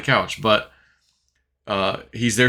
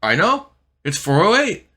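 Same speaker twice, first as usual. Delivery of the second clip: agitated confirmation; 7 semitones higher than usual. Retold or imitated. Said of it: "He goes".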